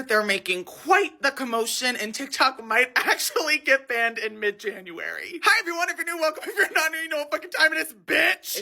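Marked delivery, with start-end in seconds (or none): 5.4-8.6 high, bright host greeting, raised; chirpy intro to the viewers; bubbly show intro. Acted. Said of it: "It's time for the Daily Scream".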